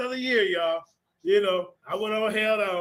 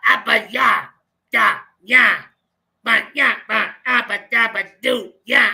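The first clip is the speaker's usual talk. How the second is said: louder mock chant of Abba Yah; mocking the Apostle; 6 dB louder than usual. Mocking the Apostle's made-up Paleo Hebrew, loud chant. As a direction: ham up the Apostle's gibberish chant. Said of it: "the Apostle talking about something".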